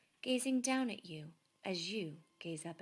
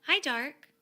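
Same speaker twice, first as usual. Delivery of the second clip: much higher, louder hello; cheerful Laszlo; 8 semitones higher, 12 dB louder than usual. A narrator's reading. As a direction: call it out happily. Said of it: "he said".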